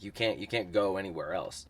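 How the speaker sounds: normal talking voice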